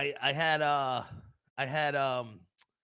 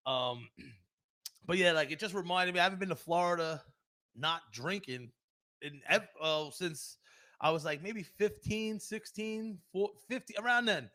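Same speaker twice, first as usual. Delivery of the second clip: ordinary talk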